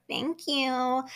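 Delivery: put-on girlish niceness; cute and nice. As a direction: imitate someone being cutesy and nice. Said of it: "All cute and nice".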